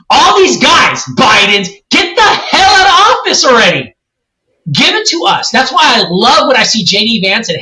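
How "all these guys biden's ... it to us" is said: mad rant. Real, not acted. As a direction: vent at the politicians, really worked up. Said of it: "You sound mad".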